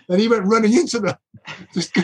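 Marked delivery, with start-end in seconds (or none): none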